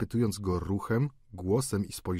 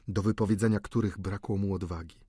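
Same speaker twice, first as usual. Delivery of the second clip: plain reading voice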